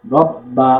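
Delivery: "রব বা" with ব pressed and held double; firm doubled consonant. Shown as a demonstration. Teacher repeating the shadda drill, firm doubled ব in "রব বা". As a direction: hold the ব, then release "বা" in "রব বা". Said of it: "শক্ত করে পড়তে হবে".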